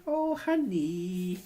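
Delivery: usual speaking voice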